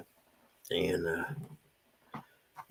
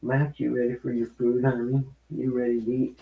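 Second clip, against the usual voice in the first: higher, sweet voice for the cat; pet talk; 4 semitones higher than usual. Real, not acted. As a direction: coo at the cat before feeding. Talking to his cat Max.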